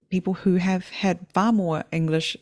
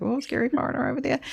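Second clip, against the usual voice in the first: mock scared local; 9 semitones higher, faster than usual. Retold fearful neighbourhood reaction.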